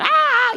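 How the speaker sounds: very high short squeal; mock the dancer's yelp; high can-can whoop demo. Shown as a demonstration. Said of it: "You're supposed to be screaming".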